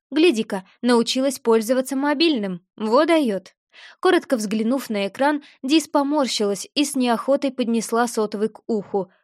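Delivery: ordinary delivery, nothing marked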